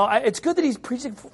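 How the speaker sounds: ordinary talk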